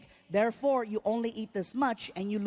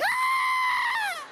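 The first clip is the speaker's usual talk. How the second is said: very high sustained shriek; loud, high-pitched scream; 4 dB louder than usual. Acted. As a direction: let out a long, piercing "Ah!". Startled reaction in a story.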